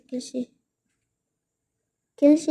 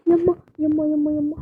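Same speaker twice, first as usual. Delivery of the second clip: excited gasp; 4 semitones higher than usual. Real, not acted. Overjoyed after buying.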